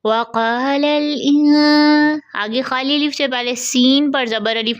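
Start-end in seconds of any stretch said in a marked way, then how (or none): 0.1-2.2 slow recited वक़ालल इन with hidden nasal; Quranic recitation. Shown as a demonstration. Teacher recites the verse slowly for students. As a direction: draw it out in recitation voice. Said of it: "इख्फा का कायदा".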